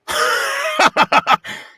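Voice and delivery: loud, high mock guffaw; exaggerated ha-ha-ha, very loud; J K Simmons. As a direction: burst out in a big derisive movie laugh. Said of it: "I did that thing from J K Simmons".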